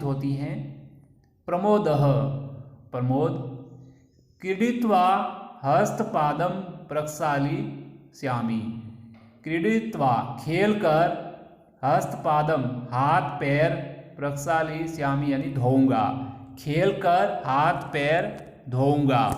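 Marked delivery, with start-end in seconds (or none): none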